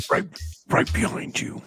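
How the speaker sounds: breathy creepy whisper into the mic. Pretend. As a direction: whisper it like a stalker. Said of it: "it's not as creepy".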